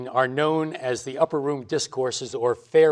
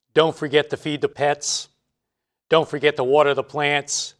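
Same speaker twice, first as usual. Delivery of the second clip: normal talking voice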